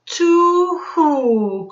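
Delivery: high, loud hooting owl. Acted. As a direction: hoot it out like an owl in the night. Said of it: "called an owl".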